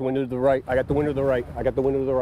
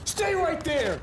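cover me shout; much slower than usual. Real, not acted. Officer holding a suspect in place.

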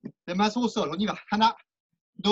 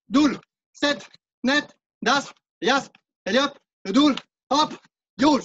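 en sautant; 4 semitones higher, 4 dB louder, much slower than usual. Real, not acted. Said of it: "Doul en sautant".

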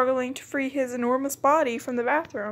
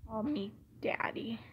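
hushed whisper; 12 dB quieter than usual. Pretend. Whispering a card answer while playing. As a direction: breathe it out with no voice.

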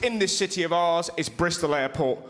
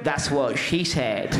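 much lower, slow smug joke; smug punchline; 7 semitones lower, slower than usual. Retold or imitated. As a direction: drawl the gag slowly and low. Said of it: "the original voice went".

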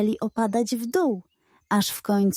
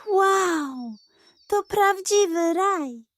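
delighted amazement; 9 semitones higher, slower than usual. Acted. Dog amazed at candy land.

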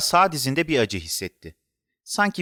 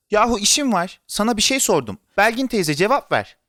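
put-on high, raised voice of an impatient child; annoyed; 7 semitones higher, 3 dB louder than usual. A narrator's reading. Boy pressing Belgin teyze for an answer, annoyed. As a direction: demand an answer, cross and insistent. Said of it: "bu defa kızdı".

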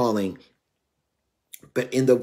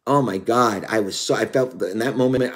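ordinary delivery

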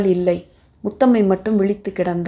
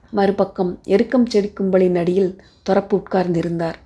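ordinary delivery, nothing marked

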